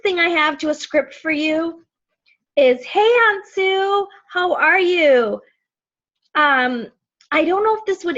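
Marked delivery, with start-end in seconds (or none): 2.8-5.4 high, drawn-out hello; slow sing-song friendly greeting; cheery greeting. Shown as a demonstration. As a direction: greet your aunt slowly and sweetly, pitched up. Voices a sample message to aunt.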